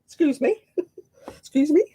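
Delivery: high, affronted excuse me; imitating an indignant challenge to a stranger. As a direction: challenge the intruder with disbelief. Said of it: "I'm like".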